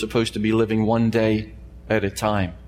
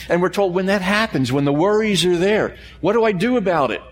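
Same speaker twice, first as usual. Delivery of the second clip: worried question; 7 semitones higher than usual. Acted. Preacher voicing the worried believer.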